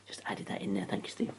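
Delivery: whispered aside. Real, not acted. Aside off-mic to colleague.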